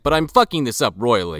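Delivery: ordinary talk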